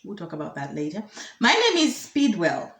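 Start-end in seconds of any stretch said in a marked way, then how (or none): 1.4-2.7 higher, slow, careful self-introduction; reporter introducing himself. Acted. Reporter gives the minister his name, slow and higher. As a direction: present yourself slowly to an elder. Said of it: "this reporter says".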